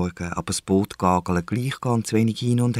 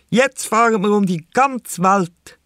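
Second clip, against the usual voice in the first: proud little captain; 9 semitones higher, 4 dB louder, slower than usual. A narrator's reading. Girl playing captain continues.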